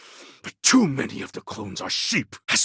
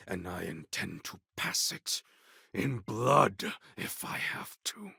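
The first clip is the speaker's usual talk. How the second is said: soft, threatening whisper; a vow of blood, whispered; menacing whisper; 3 semitones lower, 9 dB quieter than usual. Acted. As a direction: hiss the threat low.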